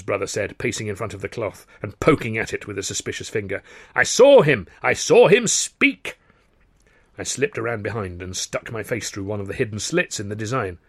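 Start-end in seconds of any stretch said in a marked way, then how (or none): 3.9-6.2 loud, high, emphatic protest; insist, stretching out the final words; indignant insistence. Acted. Indignant brother insists on what he saw.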